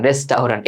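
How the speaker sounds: রেস্টুরেন্ট as "res-tau-rant"; spelling pronunciation. Shown as a demonstration. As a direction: sound the au as "ow". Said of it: "এভাবে পড়তাম".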